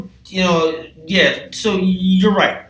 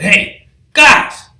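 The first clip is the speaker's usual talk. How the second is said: loud, slow, outraged shouting; angry yell; 9 semitones higher, 9 dB louder, slower than usual. Retold or imitated. Yelling at the animals lounging in his house, furious. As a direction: bellow at the intruders to get answers.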